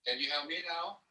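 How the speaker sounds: faint voice, far from the phone; speaking from across the room on speakerphone; phone held away. Real, not acted. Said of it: "why don't you hold up your phone and talk into the phone so".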